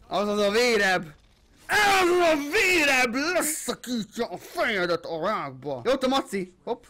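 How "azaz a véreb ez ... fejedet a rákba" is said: hammed-up warrior threat; Hound war cry. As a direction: roar it like the Hound. Declares himself as the Hound.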